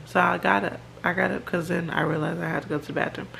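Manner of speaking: ordinary talk, nothing marked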